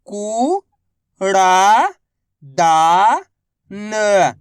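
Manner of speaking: कूड़ा dragged very slowly, then दा and न apart; teacher drawing out the syllables; stretched sounding out. Shown as a demonstration. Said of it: "तो बताइए मिलके क्या बनेगा".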